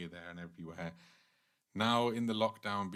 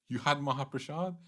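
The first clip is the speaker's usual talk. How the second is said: higher, mock-outraged question; angry disbelief; 5 semitones higher, 4 dB louder than usual. Durga Mata confronts Shiva over the Mahaprasad, acted angry disbelief. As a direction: confront him in acted indignation. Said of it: "Durga Mata became angry".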